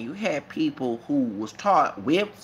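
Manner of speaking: ordinary talk, nothing marked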